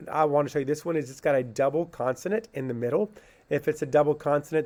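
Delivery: ordinary delivery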